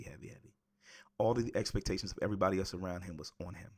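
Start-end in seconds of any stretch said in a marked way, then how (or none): 0.0-0.5 heavy, heavy breathed out in a whisper; hushed, weighted heavy, heavy. Real, not acted. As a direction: let heavy fade to a whisper. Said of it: "it was heavy".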